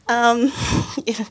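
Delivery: ordinary delivery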